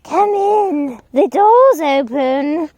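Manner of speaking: put-on high, slow wheezy old granny; high wheezy grandmother voice; wheezing granny. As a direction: wheeze it out like a frail old woman. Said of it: "wheezed Granny".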